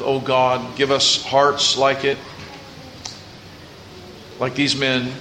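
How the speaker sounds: slow and low, like a prayer; solemn prayerful preaching. Acted.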